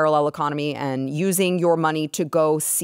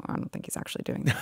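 quick whispered retraction; hushed, hurried correction; whispered aside; 12 dB quieter, much faster than usual. Real, not acted. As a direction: whisper it fast as a confiding aside. Quick aside undercutting her claim.

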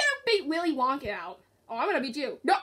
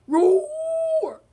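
high, loud pretend tiger roar; 12 semitones higher, 6 dB louder than usual. Player voicing Tiger in a game, high drawn-out roar. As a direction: draw out a high mock roar.

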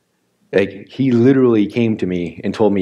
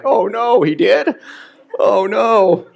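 high, hammed-up cry of dismay; dismayed surprise; 10 semitones higher, much slower than usual. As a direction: play up the shock, pitch way up. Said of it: "my response was like".

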